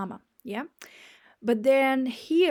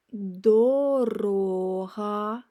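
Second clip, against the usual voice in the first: slow; 3 dB louder, much slower than usual. Shown as a demonstration. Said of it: "reading word list".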